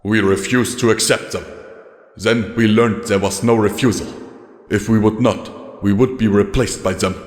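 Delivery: a lightly voiced weary old soldier, grave; tired commander recounting losses; weary legate. Legate continues speaking.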